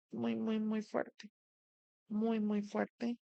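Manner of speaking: each muy slow and drawn out; slow emphasis. Real, not acted. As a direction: lean on every muy, slow and quiet. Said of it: "emphatic slow repetition".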